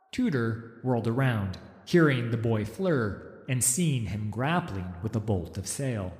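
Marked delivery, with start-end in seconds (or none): none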